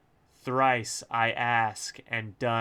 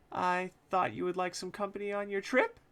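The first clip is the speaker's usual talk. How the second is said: higher, sweetly innocent fib; 9 semitones higher than usual. Acted. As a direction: sell an obvious lie with innocence. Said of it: "in a voice obviously intended to ask if I will just accept the obvious lie".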